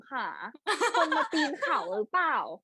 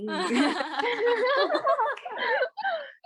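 squealing high laugh; high laughing fit; 9 semitones higher than usual. Real, not acted. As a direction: burst into shrieking laughter. Laughing during the game.

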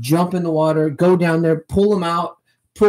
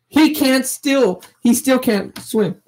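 exasperated; 10 semitones higher than usual. Real, not acted. Father exasperated about son.

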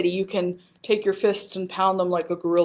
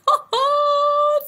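bright high giggle; giggling at the silly rhyme. Real, not acted. Laughs at own rhyme.

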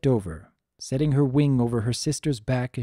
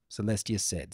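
ordinary delivery, nothing marked

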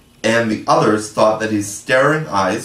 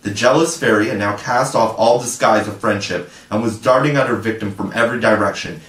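ordinary delivery, nothing marked

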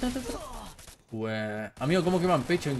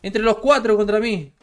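outraged complaint; 7 semitones higher, 6 dB louder, slower than usual. Real, not acted. Complaining after being killed.

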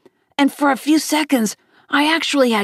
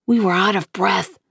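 plain reading voice

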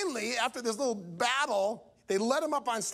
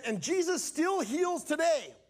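higher, slow preacher's declaration; preaching; 6 semitones higher, slower than usual. Retold or imitated. Evangelist declaring healing to the crowd, preaching. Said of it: "in his sermon".

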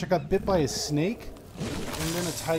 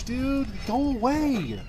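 high-pitched, exasperated alarm; alarmed pleading; 9 semitones higher, slower than usual. Real, not acted. Player chased by a game animal, alarmed. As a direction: plead in a high voice, falling at the end.

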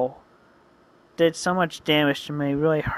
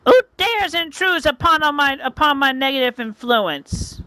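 much higher, indignant witch challenge; 8 semitones higher than usual. Acted. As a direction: demand who dares, as the witch. Witch confronts intruder.

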